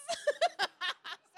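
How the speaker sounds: high burst of real laughter; real laughter, pitched high; laughing at own impression. Real, not acted. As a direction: break out laughing at the joke. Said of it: "laughing at own impression".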